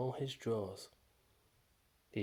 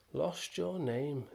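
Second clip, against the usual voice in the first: higher, slow, louder snuffle; snuffled; 6 semitones higher, 4 dB louder, slower than usual. Acted. Aardvark snuffling over the boy's lost name, slow and high. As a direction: sniff the words out sympathetically. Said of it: "the aardvark snuffled".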